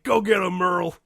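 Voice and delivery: loud, high cheer at the actor; cheering shout. Retold or imitated. Fan in line yelling encouragement at actor, loud cheer. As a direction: egg him on like a fan. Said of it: "not to just shout out".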